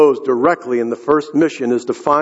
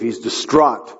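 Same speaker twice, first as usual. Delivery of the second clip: normal talking voice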